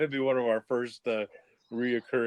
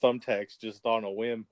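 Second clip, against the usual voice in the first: usual speaking voice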